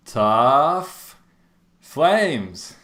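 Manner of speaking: big drawn-out ring announcer call; hype announcer. Acted. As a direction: announce him like a fight champion. Hype introduction of interview guest.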